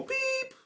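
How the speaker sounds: high, whispered censor bleep; mock censor beep. Covering a swear word in a [name] song, beep. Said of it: "I go".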